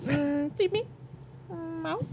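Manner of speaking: tiny high, soft cat voice; begging cat. Acted. Stray cat on a sleeper, begging. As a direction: mew it like a hungry cat. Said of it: "Just being like".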